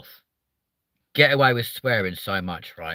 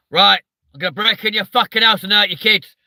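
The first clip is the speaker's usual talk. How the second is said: loud, higher southern English threat; southern English accent; 7 semitones higher, 6 dB louder than usual. Retold or imitated. Streamer showing a southerner's scary threat, loud southern accent. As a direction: menace them in an aggressive southern English accent. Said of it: "a southerner to go".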